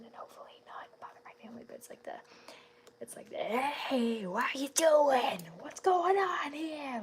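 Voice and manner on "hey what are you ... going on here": loud, raspy, slowed-down British-free cartoon menace; loud raspy villain voice demo; raspy Skywarp voice. Shown as a demonstration. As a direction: rasp it up loud like a chaotic Decepticon. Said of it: "Like that kind of".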